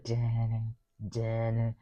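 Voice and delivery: low, soft suspense sting sung by mouth; imitated dramatic music. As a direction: hum out an ominous musical cue, very quietly. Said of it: "And then the music's like".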